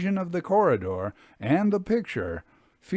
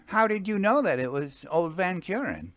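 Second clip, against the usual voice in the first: higher voice for the friend; Jasper, curious; 8 semitones higher than usual. A narrator's reading. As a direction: ask with keen interest. Jasper asks.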